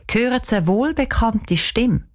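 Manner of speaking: plain reading voice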